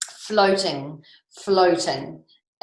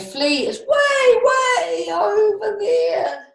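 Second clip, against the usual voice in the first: high, louder call over a distance; far-off calling; 14 semitones higher, 4 dB louder than usual. A narrator's reading. Reader calls the flea's distance high and loud.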